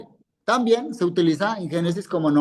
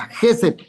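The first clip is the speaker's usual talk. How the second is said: "Gesed" with a louder, higher Ge; stress on first syllable; 4 semitones higher, 5 dB louder than usual. Shown as a demonstration. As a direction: punch the first syllable of "Gesed", then fall. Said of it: "acento allá".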